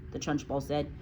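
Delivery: usual speaking voice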